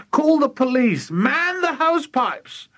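high, urgent call for help; frantic high character voice; panicked cry. A narrator's reading. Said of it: "cried Missus Cranky".

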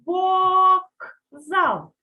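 вокзал split loudly as вок-зал, with a crisp voiceless k; high, drawn-out вок, then зал, as written; spelling pronunciation. Shown as a demonstration. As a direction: sound out вокзал for spelling with a clear k. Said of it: "яку букву напишемо".

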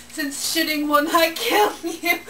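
ordinary delivery

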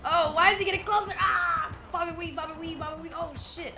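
high, loud panicked yelling; panicking gamer. Real, not acted. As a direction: scream as the killer closes. Killer getting closer.

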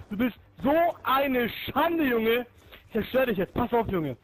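normal talking voice

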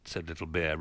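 ordinary delivery, nothing marked

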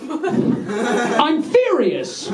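high, hugely drawn-out outburst; mock fury. Comic dropping the calm over a phone, mock fury. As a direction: explode slowly in hammed-up rage.

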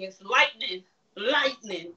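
lightning said as two syllables, light-ning; lightning with the E taken out; correct pronunciation. Shown as a demonstration. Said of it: "take that E out".

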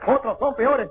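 ordinary delivery

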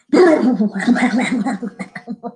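wobbling, blubbery walrus noise; walrus bellow. Shown as a demonstration. Librarian guessing at a walrus sound for children, wobbly bellow. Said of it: "how do you think the walrus bellows?".

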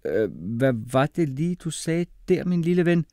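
incredulous question rising in pitch; double take. A narrator's reading. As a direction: stop short and question in disbelief. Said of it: "afbrød sig selv".

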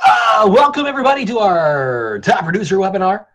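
hammed-up radio announcer, high and loud; big mock call-in contest announcement. Welcoming everybody to the webinar, hammed-up radio voice. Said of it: "can you do that radio voice".

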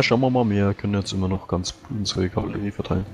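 normal talking voice

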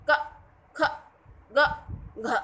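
क, ख, ग, घ said clipped, with no vowel after the consonant; halant consonant demo. Shown as a demonstration. Teacher reading halant letters without the inherent vowel. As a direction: cut each letter off with no vowel. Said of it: "अब मैंने ऐसे क्यों पढ़ा क".

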